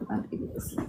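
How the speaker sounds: whispering. Real, not acted. Mother quieting near sleeping baby.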